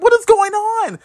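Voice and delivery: high, strained bewildered cry. Retold or imitated. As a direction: sound totally lost in the chaos. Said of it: "I was like".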